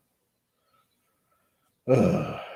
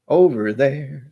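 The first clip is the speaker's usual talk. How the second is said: OVER THERE drawn out slowly, louder and higher; slow, raised, hammed-up "Over there"; exaggerated repeat; 5 semitones higher, 5 dB louder, much slower than usual. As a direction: stretch both words of "Over there" in a playful put-on voice.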